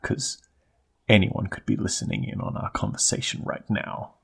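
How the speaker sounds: secretly delighted hush; conspiratorial whisper. Pretend. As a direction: whisper like spies are listening. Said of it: "low conspiratorial voice".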